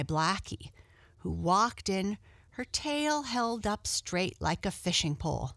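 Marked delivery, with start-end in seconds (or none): none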